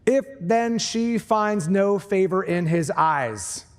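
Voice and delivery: slow, weighted reading of "If then she finds no favor in his eyes"; emphasis on the phrase. Shown as a demonstration. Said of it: "They're gonna put emphasis on this next phrase".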